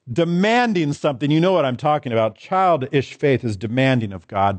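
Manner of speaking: ordinary delivery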